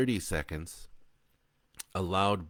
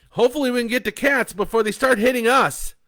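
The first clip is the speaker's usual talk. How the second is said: loud, fast, higher urgency; urgent; 13 dB louder, faster than usual. Acted. Bo driving urgently.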